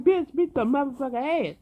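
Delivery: high, excited yell; gleeful egging on; hyped urging. Retold or imitated. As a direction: egg her on. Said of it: "I'm like".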